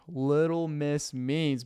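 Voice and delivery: ordinary talk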